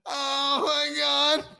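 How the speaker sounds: slow, high wheeze between laughs; helpless tearful laughter; drawn-out gasping oh my God; crying with laughter. Real, not acted. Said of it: "I'm literally crying".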